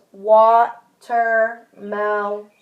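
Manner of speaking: watermelon stretched very slowly syllable by syllable; slowly segmented. Shown as a demonstration. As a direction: pull watermelon apart, slow and drawn out. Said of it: "They might go like this".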